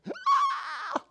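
very high-pitched happy shriek; delighted squeal. Retold or imitated. Father seeing his daughter, high delighted squeal. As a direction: squeal at a long-missed loved one. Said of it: "When I see her, I'm like".

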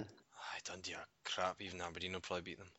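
soft whispered jab; quiet whispered dig at Dundee; whispered aside. Real, not acted. Whispered aside about rival team.

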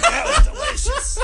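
high, loud quote through laughter; laughing quote. Retold or imitated. Quoting Squirrel after his burp, high and laughing. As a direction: crack up while quoting him.

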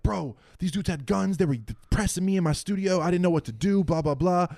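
high-pitched, urgent mock yell; panicked retold shouting at a friend. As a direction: rant at the guy who set this up. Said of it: "I'm yelling at him like".

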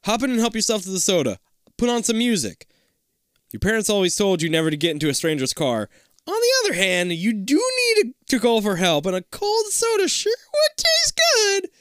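high, coaxing sales voice; creepy driver tempting kids into the limo; slick driver. Acted. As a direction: lure the kids in sweetly.

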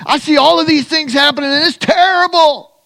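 high anguished complaint; crying out. Retold or imitated. Jeremiah crying out to God, high and anguished. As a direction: wail it up to God in despair. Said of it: "he's crying out to God".

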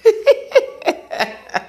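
very high, breathy laugh; high cackle. Real, not acted. Husband laughing at his wife's ho ho joke, high and breathy. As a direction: burst into a squealing laugh.

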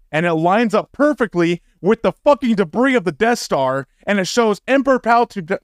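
worked-up rant, pitched high; so fucking angry. Real, not acted. Podcaster raging over the Death Star debris, angry. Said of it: "I'm stuttering 'cause I'm so fucking angry".

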